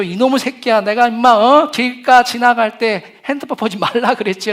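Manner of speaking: put-on father yells at his child after a near miss, loud and angry; angry parent. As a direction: scold the careless kid furiously. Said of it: "일단 화를 내겠죠".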